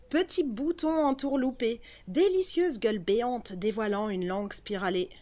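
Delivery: usual speaking voice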